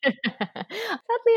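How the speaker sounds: high laughing word. Real, not acted. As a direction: giggle the word out. Laughing reply.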